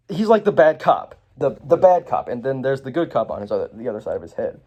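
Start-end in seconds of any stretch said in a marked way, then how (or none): none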